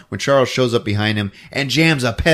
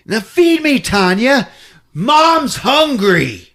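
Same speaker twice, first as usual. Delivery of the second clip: high, loud, hammed-up yell; mock monster demand; 11 semitones higher, 5 dB louder, much slower than usual. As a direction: shout it, stretching the last phrase.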